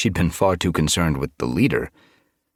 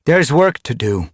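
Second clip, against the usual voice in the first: raised, higher, commanding call; stern; 9 semitones higher, 6 dB louder than usual. A narrator's reading. Ice Star hushes her chanting clan, stern. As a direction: call for silence firmly. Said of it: "her stern gaze calling for silence".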